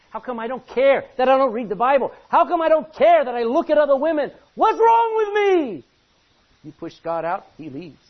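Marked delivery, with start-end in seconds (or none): 0.1-5.8 high, loud anguished cry to God; anguished outcry. Retold or imitated. Sinner crying out to God about his own coldness, loud and anguished. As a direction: cry out in desperate self-reproach.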